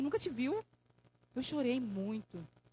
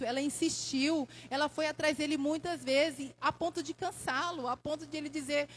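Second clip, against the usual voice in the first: ordinary talk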